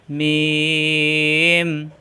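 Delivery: মিম with a long held humming m from closed lips; মিম drawn out, lips closed then released; demonstrating the letter sound. Shown as a demonstration. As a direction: hold the m of মিম long, lips pressed together. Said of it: "মিলানো ঠোঁট ছেড়ে দিয়ে".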